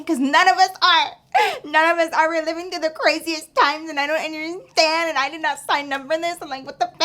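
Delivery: high, loud bursts of disbelief; a worked-up, high-pitched mini meltdown; exasperated rant. Acted. Storyteller checking in on bestie about crazy times, exasperated.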